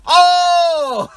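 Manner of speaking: very high, loud shout of oh; shouted realization. Retold or imitated. Russian friend suddenly understanding bye-bye, shouted oh. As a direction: burst out as it clicks.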